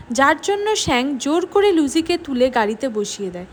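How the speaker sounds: usual speaking voice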